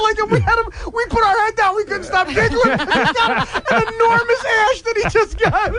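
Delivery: giggling through the story; laughing while telling. Real, not acted. Retelling bookie story, cracking up.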